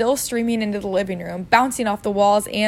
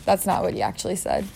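plain reading voice

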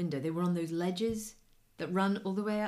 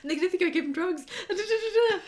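higher and louder, panicked and laughing through it; mock panic, retold with a laugh; playing the frantic colleague, half laughing; freaking out; 10 semitones higher, 6 dB louder than usual. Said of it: "he was freaking out".

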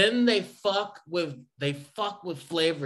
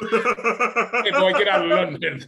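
louder hammed-up Southern yell, laughing through; a laughing Southern bully shouting; mock Southern accent, laughing; 2 semitones higher, 6 dB louder, slower than usual. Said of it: "a Southern accent".

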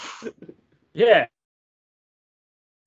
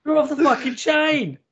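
mock catchphrase; 12 semitones higher, 4 dB louder than usual. Echoing catchphrase, high put-on voice.

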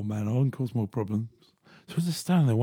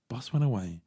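ordinary talk, nothing marked

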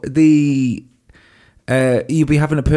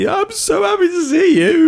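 much higher, sing-song put-on brightness; fake cheerful. Answering a parent after a dark thought, falsely cheery. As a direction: hide the dark thought behind chirpy glee. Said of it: "you go".

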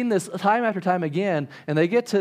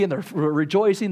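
ordinary talk

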